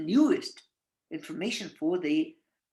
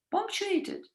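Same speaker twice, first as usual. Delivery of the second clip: usual speaking voice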